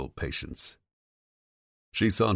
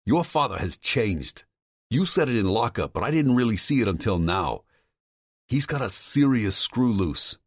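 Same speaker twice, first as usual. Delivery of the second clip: higher, agitated male voice on the phone; a worried friend pushing hard, voice raised in pitch; Tom's voice; 7 semitones higher, 3 dB louder than usual. Acted. Tom warning Vincent about his father, higher and agitated.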